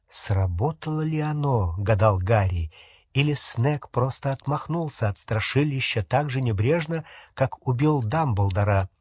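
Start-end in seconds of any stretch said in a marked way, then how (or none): none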